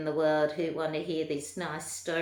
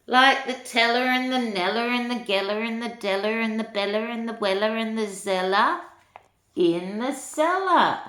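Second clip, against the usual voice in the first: rattled off; 5 semitones higher, 7 dB louder than usual. A narrator's reading. Rattling off rhyming names.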